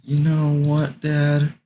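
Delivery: high put-on kid voice; son's voice. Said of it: "He's like".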